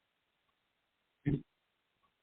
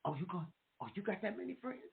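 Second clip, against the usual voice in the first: teasing under breath; 15 dB quieter, faster than usual. Real, not acted. Teasing the host aside.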